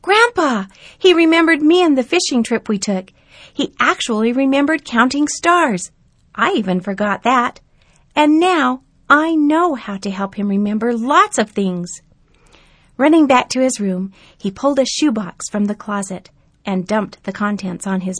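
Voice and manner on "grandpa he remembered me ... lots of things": put-on high, loud, excited boy's voice; a boy telling mom the good news; thrilled young boy. Narrator voices thrilled grandson.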